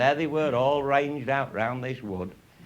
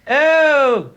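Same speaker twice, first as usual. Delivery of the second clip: high, loud, fretting posh voice; mock posh lady; 13 semitones higher, 11 dB louder than usual. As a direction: send up the toffs in falsetto.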